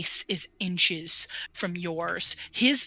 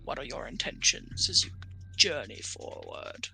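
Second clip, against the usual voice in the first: undead dragon voice; 6 semitones lower, 5 dB quieter than usual. Acted. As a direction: speak as an ancient undead dragon.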